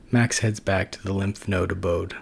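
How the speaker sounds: ordinary delivery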